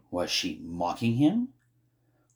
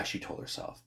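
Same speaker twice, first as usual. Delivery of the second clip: plain reading voice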